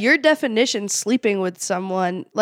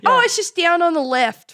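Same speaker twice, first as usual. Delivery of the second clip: higher-pitched attempt at an Australian accent; 10 semitones higher, 3 dB louder than usual. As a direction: have a go at sounding Australian. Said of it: "an Australian or something".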